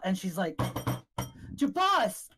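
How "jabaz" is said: high-pitched alarmed call. Acted. Shocked woman calls for her companion, high alarmed call. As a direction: shout the name upward, urgently.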